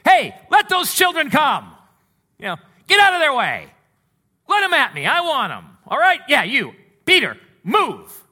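loud, high, indignant calling out; exasperated shouting at the disciples; annoyed Jesus. Retold or imitated. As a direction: shoo the disciples aside, irritated. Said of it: "it says there he was indignant".

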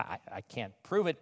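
ordinary talk, nothing marked

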